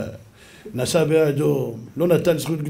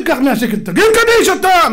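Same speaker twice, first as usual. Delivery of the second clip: mocking quote; 11 semitones higher, 6 dB louder than usual. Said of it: "מה זה".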